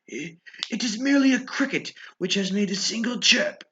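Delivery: put-on high, anxious old man comforting himself; frightened old man.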